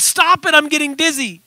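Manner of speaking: high-pitched dizzy plea; yelled protest. Acted. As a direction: call out to make it stop, pitched high. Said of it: "And he yelled".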